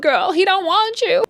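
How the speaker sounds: high, sassy mocking voice; playful high diss; mock sassy girl. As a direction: toss it off like a sassy friend. Mocking aside, high and far.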